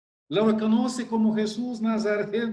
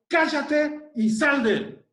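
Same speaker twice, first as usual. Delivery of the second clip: higher, slower commanding rebuke; stern rebuke; 5 semitones higher, 3 dB louder, slower than usual. Acted. Jesus rebuking the unclean spirit, stern and commanding. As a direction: order the spirit out with full authority. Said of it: "Jesús le reprendió diciendo".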